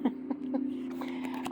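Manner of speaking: quiet, breathy chuckle; real little laugh under the breath; soft giggle. Real, not acted. Reviewer tickled by the devil card, soft giggle.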